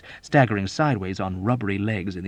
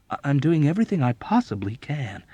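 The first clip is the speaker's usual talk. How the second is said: usual speaking voice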